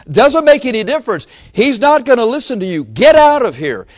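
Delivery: high, loud brush-off; dismissive shout. Retold or imitated. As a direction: shoo her away loudly. Disciples shouting her away.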